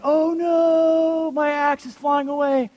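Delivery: drawn-out, high-pitched mock yell; slow-motion cry. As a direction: stretch every word like a slow-motion movie disaster. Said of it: "like, flying in slow motion".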